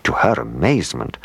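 ordinary delivery, nothing marked

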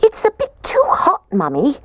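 very high squeak; squeaky baby bear. A narrator's reading. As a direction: squeak it like a tiny bear cub. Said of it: "The little baby bear squeaked".

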